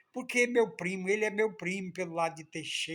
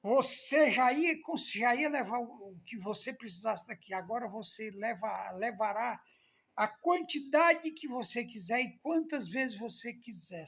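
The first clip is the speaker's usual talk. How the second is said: excited promise; 6 semitones higher, 4 dB louder than usual. Retold or imitated. Mayor's generous promise.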